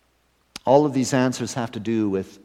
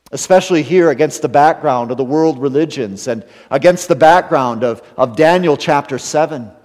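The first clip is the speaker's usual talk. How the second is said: louder, rising pulpit preaching; preaching at full force; 5 semitones higher, 7 dB louder than usual. Acted. Pastor driving home Daniel's vision to congregation, full-force preaching. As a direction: build the sermon to a strong climax.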